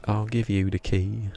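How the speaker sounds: drawn-out Somerset singalong; playful West Country yokel lilt; yokel Somerset accent. Shown as a demonstration. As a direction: croon it like a Somerset farmer. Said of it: "do a good yokel Somerset accent".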